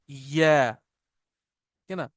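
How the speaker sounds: या stretched slowly from an open mouth; drawn-out ya. Shown as a demonstration. Teacher demonstrating Arabic letters to class, slow या. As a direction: let या ring out long. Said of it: "असल में ये निकल रहा है हमारे मुंह से".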